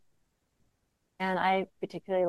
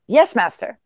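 a somewhat louder, quick reply; prompt answer; 4 semitones higher, 9 dB louder than usual. Acted. Monk answering his teacher, prompt, a bit louder.

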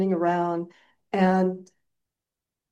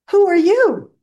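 Dorothy asking; 12 semitones higher, 9 dB louder than usual. Retold or imitated. Dorothy confronting the wizard.

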